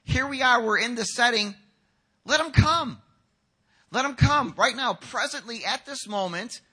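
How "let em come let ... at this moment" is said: high, forceful order; loud command. Acted. Jesus ordering disciples to let children through, loud command. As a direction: call it out with urgent authority.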